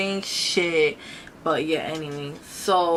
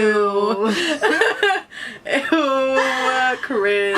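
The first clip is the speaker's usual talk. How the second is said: loud high cringing laugh; 5 semitones higher, 8 dB louder, much slower than usual. Real, not acted. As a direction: squeal in embarrassment. Reacting to own story.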